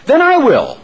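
high, loud resolve; a plucky hen stepping up; determined hen. A narrator's reading. Preacher as the hen, high loud resolve. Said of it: "said the little red hen".

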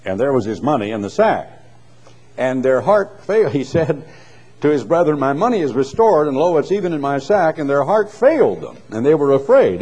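lifted public reading of scripture. Acted. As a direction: read the King James like from a pulpit. Reads scripture aloud.

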